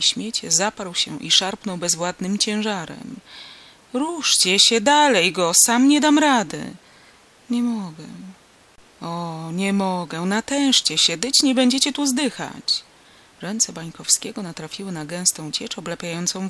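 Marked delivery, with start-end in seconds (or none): 3.9-6.7 loud, high effortful shout; raised, strained exhortation; straining urging. A narrator's reading. Farmer straining to lift heavy body. 7.5-10.2 weak answer from an injured man; feeble refusal. A narrator's reading. 10.2-12.8 louder, rising push to make an effort; raised, exasperated encouragement; urgent exhortation. A narrator's reading. Farmer urges injured man on.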